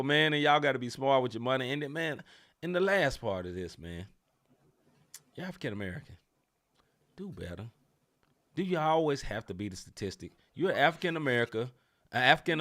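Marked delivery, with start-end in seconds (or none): 5.3-7.7 low scolding. Real, not acted. Pundit scolding a player's father, low and soft. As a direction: lower and much softer, dead serious.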